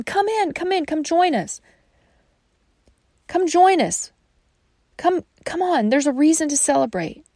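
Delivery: pleading father. Acted. Father urging his angry son to come in, coaxing. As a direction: gently beg him to join the party.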